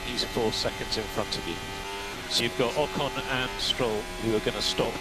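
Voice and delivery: usual speaking voice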